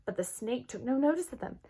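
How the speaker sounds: ordinary delivery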